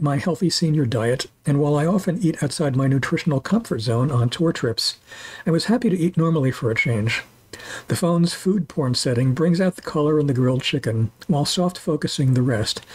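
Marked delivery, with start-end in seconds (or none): none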